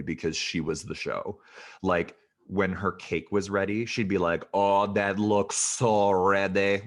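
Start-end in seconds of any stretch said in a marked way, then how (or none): 4.5-6.8 put-on slow, drawn-out Québécois accent in English; super Quebec French accent. Baker saying her cake is ready, strong Quebec French accent. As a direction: imitate a thick French Quebec accent, stretching the words. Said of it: "just that French, super Quebec act".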